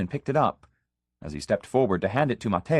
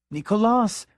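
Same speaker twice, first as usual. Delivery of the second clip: cried out much higher; very high cry; 12 semitones higher, 3 dB louder than usual. A narrator's reading. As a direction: cry the name up high in protest. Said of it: "his voice very high".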